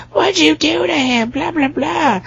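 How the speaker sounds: very high squeaky mock voice; high mock girl voice. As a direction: shriek it like a silly teen girl. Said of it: "is like".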